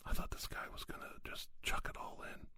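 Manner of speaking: ordinary talk, nothing marked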